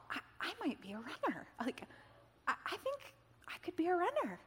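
soft, halting, pitch lifting high in amazement; tentative wonder. Retold or imitated. New runner thinking to herself on the green belt, tentative wonder. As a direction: let the hope dawn shyly, half to yourself. Retold dawning hope while running.

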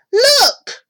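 high, loud exclamation; alarmed cry. A narrator's reading. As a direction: cry out to point at danger. Girl pointing out the thieves.

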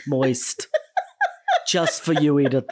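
"Moist" drawn out teasingly, in a put-on voice. Teasing a listener who hates the word, playful put-on voice. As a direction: tease Edith with the word "Moist". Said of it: "she hates the word moist".

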